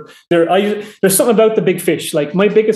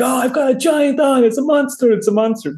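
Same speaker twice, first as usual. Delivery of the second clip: high, excited yell without the volume; mock distant shouting; 7 semitones higher than usual. Angler as overheard by a farmer across the land, mock shouting. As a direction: imitate a crazed angler hollering from far away. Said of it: "this crazy Irish guy just shouting in the distance".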